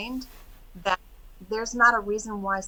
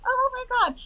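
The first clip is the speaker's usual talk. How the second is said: retold gushing disbelief, pitched way up; overwhelmed amazement; faster than usual. Hearing praise of the lady's change, amazed. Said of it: "And I was like".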